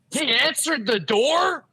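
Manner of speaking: stretched-out, high-pitched astonished question; incredulous retort. Retold or imitated. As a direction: imitate an uncle who can't believe it. Retold uncle reacting in disbelief.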